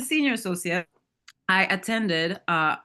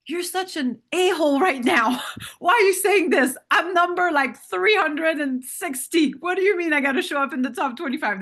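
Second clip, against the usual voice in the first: higher and louder, a hammed-up inner outburst; indignant inner voice, much higher and louder; mock outraged inner voice; 8 semitones higher, 6 dB louder than usual. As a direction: let the retold panic burst out high and loud. Panicked inner retort to mentor.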